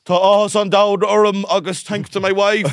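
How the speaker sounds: booming announcer voice, high and loud; bellowing into a microphone. Retold or imitated. Mimicking the Cork mayor booming at a launch, bellowing. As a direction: bellow it like a mayor at Croke Park. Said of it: "the way they talk into the microphone at Croke Park".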